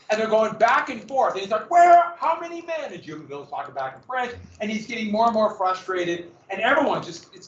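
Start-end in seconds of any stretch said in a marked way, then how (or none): 1.7-2.9 acted high-pitched shout, opening loud; screaming interrogation. Washington interrogating a French prisoner, screaming. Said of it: "he's just screaming at him".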